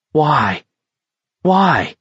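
high, falling cry of why; anguished questioning. A narrator's reading. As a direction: ask it in rising anguish. Anguished thought after cursing McVries.

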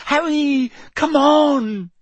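very high, loud, desperate cry; frantic urging. A narrator's reading. Terrified Hermione drags Harry away, frantic urging. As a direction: cry out to hurry a friend.

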